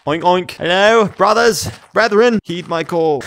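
mock summoning. Hungry player summoning pigs, mock grand call.

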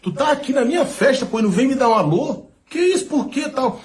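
high, laughing mock complaint from a friend; mock hurt reproach. [name] teasing [name] for not greeting him, laughing. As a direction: play the offended buddy, half laughing. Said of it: "[name] quoted reproaching".

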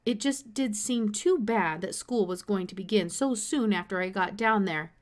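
ordinary delivery, nothing marked

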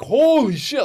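high, loud burst of delight; a kid who can't believe the payday; thrilled exclaim. Retold or imitated. Young comic thrilled at three hundred bucks, high loud exclaim. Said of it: "which is like".

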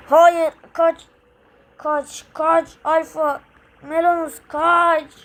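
sudden loud cry to flee; panicked shout. Acted. Hero yelling to friends to run, panicked shout. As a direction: yell at them to run.

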